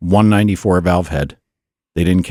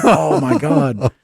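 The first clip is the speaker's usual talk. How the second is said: shocked laugh; 6 semitones higher, much slower than usual. Retold or imitated. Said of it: "I'm like".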